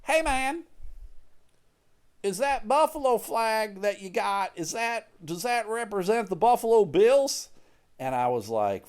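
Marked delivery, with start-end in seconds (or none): 0.0-7.5 high, strained mock holler; retold yell. Neighbor asking about the Bills flag, mock yelling. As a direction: call across the yard in a raised pitch. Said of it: "he starts yelling at me".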